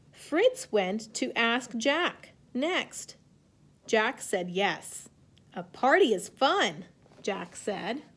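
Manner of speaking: slow, clear picture-book reading; reading to children. A narrator's reading. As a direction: read it gently to young kids. Teacher reads story aloud.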